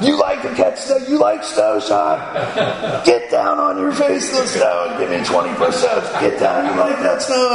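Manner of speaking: taunting drill sergeant, laughing as he barks; mocking drill sergeant. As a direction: sneer at the recruit while laughing.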